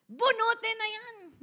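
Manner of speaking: loud, slow "Bunutin na yan"; eager command. Acted. Joking servant demands the weeds be pulled, loud slow. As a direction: order it pulled out.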